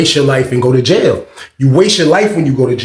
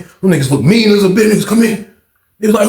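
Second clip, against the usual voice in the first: ordinary delivery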